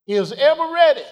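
high, loud preacher's cry; preaching climax. Acted. Preacher crying out to the congregation about love. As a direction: ring it out to the pews.

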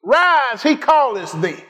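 high, rousing scripture telling; fervent preaching. Real, not acted. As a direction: proclaim the story to the congregation. Preaches at full force.

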